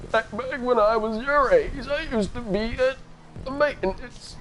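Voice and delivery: a hint of a creaky old-timer reminiscing; old man voice. Streamer trying out an old man voice for chat. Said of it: "I sound like an old man".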